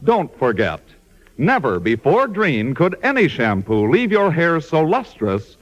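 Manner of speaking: ordinary talk, nothing marked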